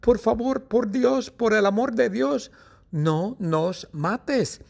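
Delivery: very high, squeaky cry for mercy; terrified pleading. Acted. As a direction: beg for your life in a tiny high-pitched voice. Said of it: "piensan que Mateo va a matarlas y gritan".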